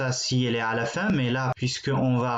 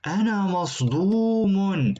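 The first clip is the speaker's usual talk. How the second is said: mock shocked; 6 semitones higher than usual. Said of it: "Celui-là est choqué".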